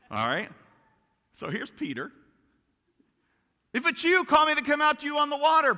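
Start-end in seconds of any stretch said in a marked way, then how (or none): 3.7-5.8 high, loud, rushed call; hammed-up eager disciple shouting fast; impulsive blurting. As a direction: blurt it out without thinking. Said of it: "Probably not even thinking".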